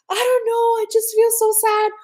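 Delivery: high, crying voice, retold; tearful. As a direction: replay the tears, voice pitched high. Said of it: "and I just started crying".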